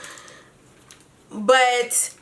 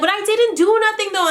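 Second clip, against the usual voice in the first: high whiny protest; whiny innocent protest; 9 semitones higher than usual. Retold or imitated. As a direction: plead innocence, whining. Mimicking Kiana's innocent protest.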